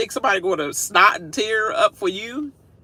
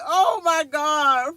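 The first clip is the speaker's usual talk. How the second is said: laughing disbelief; 10 semitones higher, much slower than usual. Retold or imitated. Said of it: "I was like".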